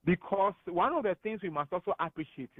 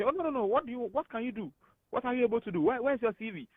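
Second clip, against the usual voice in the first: higher-pitched, insistent run of questions; insistent questioning; 8 semitones higher, faster than usual. Acted. Contact quizzing a jobless friend about skills, insistent questioning. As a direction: press them with eager, higher-pitched questions.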